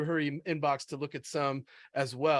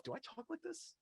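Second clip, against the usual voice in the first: high, hushed self-doubting question; 6 semitones higher than usual. Acted. Writer reading a draft aloud asks themself, self-questioning. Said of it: "you're asking yourself".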